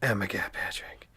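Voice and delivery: plain reading voice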